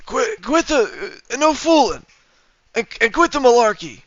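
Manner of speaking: a hammed-up attempt at Joe Biden; Biden impression. To chat, hams up a bad Biden impression. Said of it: "I can't do a Biden impression very well".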